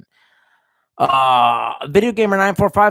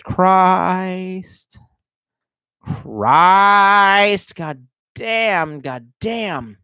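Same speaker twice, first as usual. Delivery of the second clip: long drawn-out, exasperated swearing; exasperated cursing; 3 semitones higher, 3 dB louder, much slower than usual. Real, not acted. Streamer faced with chat spamming everywhere, exasperated. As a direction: stretch each curse out, easing down by the end.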